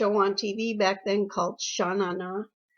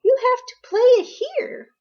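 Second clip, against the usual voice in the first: bossy big sister; 12 semitones higher, 6 dB louder than usual. Retold or imitated. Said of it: "and say".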